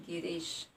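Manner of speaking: teacher's unforced demo of गिरीश; unforced long vowel. Shown as a demonstration. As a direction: let गिरीश drop out in one easy go. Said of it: "जिसका उच्चारण एकदम से हो रहा है".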